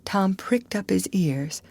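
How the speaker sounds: usual speaking voice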